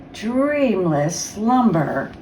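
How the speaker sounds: usual speaking voice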